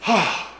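high, contented "ah"; satisfied sigh. Retold or imitated. Couple resting on the porch after yard work, satisfied sigh. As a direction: re-enact a sigh of relief after chores. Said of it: "and we thought".

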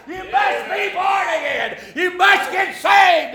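loud, high, slowed hammering on Jesus; full-force preaching. Acted. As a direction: drive the point home at full volume.

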